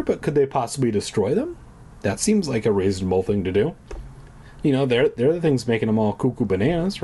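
ordinary talk

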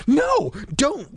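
high, urgent refusal; alarmed, high-pitched objection; insistent. A narrator's reading. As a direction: insist sharply that someone stop. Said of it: "Casey insisted".

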